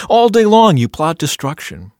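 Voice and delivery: loud, high denunciation; accusing. A narrator's reading. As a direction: denounce the wrongdoer in a dramatic reading. Dramatic reading of accusing psalm.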